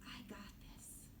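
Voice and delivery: soft unvoiced whisper to yourself; saying it to yourself. Shown as a demonstration. Teacher showing kids a private whispered pep talk. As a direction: whisper it, just for you. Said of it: "And say to yourself".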